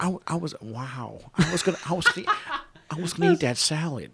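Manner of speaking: soft, halting actor impression with stammered restarts; Owen Wilson. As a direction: stammer it quietly in a put-on celebrity voice. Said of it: "my best Owen Wilson voice".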